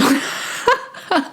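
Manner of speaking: high-pitched giggle; real high giggle. Real, not acted. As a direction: let out a high, light laugh. Amused after pointing out crystal.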